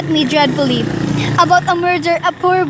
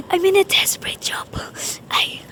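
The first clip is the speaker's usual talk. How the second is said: urgent voiceless whisper; desperate whisper; 6 dB quieter than usual. Acted. Woman begging the operator for help, frantic whisper. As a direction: plead in a hush you can barely hear. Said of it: "I cannot hear you, madam".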